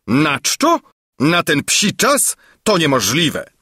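high-pitched, astonished royal outburst; incredulous exclamation. A narrator's reading. King hearing the prince went out unfed, astonished. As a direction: exclaim each phrase in mounting disbelief. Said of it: "wykrzyknął król".

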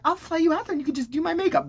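high-pitched, gushing offer; mock generous mentor. Influencer offering the kid a flight, mock eager. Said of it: "And I'm like".

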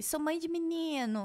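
mock TikTok mother voice, stretched out; imitating TikTok boy-mom. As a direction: mimic a TikTok mom, slow and high. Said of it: "Mulher que fala assim no TikTok".

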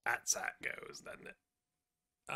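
resigned murmur. Real, not acted. Resigned after conceding.

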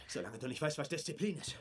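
ordinary talk

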